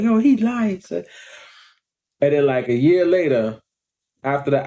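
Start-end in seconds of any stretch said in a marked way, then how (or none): 0.0-1.0 suspicious disbelief. Retold or imitated. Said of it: "I'm like".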